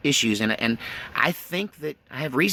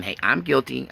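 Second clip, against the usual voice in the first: ordinary talk, nothing marked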